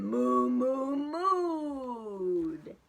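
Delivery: loud moo sounds acted out; mooing cows. Reading to kids, mooing like excited cows. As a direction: moo it like a happy cow.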